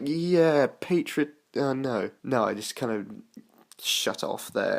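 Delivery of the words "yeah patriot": higher, put-on rah-rah enthusiasm; mock patriotic cheer. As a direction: imitate half-hearted flag-waving zeal. Mocking patriotic football speeches.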